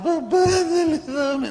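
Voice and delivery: put-on high tearful child's plea; whining child. Father imitating his child begging in tears, high and whiny. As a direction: whimper it like a begging kid. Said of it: "no me pidas con lágrimas".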